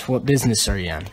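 ordinary delivery

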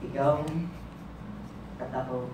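low, soft, with long pauses; hushed tender close. Acted. Poet promises lover they will be one, hushed. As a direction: let each phrase land quietly, pitch dropped. Hushed last line of poem.

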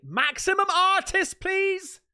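high, loud gushing praise; thrilled shout of praise; excited cry. Real, not acted. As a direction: rave about the picture at full volume. Excited praise of a fan drawing.

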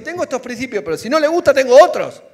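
mock a man who swaps principles; mocking cynic. Pastor mocking a man with no principles, rising loud. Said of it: "No como ese que dijo".

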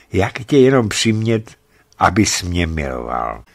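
usual speaking voice

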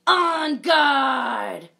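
loud, high challenge; a brave little knight's shout; battle cry. Acted. Dot raising her carrot to fight, loud.

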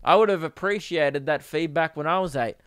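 usual speaking voice

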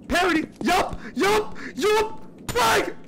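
hyped gamer. Real, not acted. Fighting in game, hyped.

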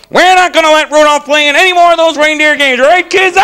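high, loud coach announcement; rally the kids against the outcast; hammed-up sports coach holler; bullying cartoon coach. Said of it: "Remember the coach? He's like".

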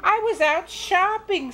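high, lilting grandmother; cheerful Grandma. Acted. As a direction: answer breezily, pitched high. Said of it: "said Grandma".

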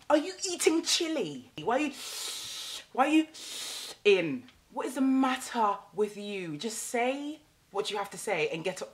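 high, loud mocking coworker voice; imitating a nosy coworker. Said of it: "before they say something".

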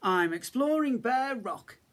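a small bear pleased with himself; little cub announcing his adventure; high little cub voice. A narrator's reading. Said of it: "Little Bear said".

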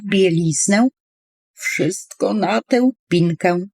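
plain reading voice

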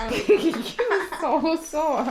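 high, drawn-out words through laughing; helpless laughter. Real, not acted. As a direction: crack up and squeeze the words out. Cracking up at the dog. (자지러지게 웃으며 높은 목소리로)

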